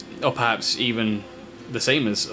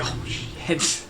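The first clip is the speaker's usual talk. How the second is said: whispered; 3 semitones higher, 7 dB quieter than usual. Acted. Oscar sees the dragon.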